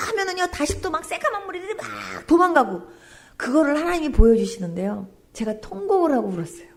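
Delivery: ordinary talk, nothing marked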